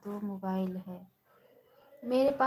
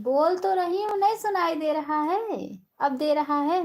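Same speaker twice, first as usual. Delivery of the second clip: calling out loudly; 7 semitones higher, 8 dB louder than usual. Real, not acted. Others can't hear her.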